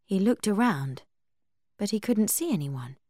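plain reading voice

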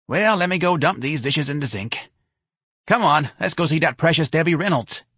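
high, brassy rallying; brisk Santa. Acted. Santa rounds up friends for the movie, brash. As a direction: hustle friends along to the show.

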